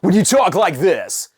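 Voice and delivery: pushed, overdriven radio delivery; mild DJ puking; puking announcer voice. Shown as a demonstration. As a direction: push every word like a DJ. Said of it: "we refer to that as called puking".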